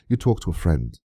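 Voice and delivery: usual speaking voice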